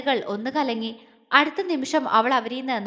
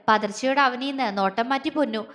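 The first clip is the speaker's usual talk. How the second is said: ordinary delivery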